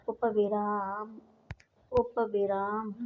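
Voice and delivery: उपविराम spoken slowly, every syllable drawn out; slow dictation. Shown as a demonstration. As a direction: spell out उपविराम slowly for the class. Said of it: "teacher dictating term slowly". (उपविराम धीरे-धीरे, रुक-रुककर बोलना)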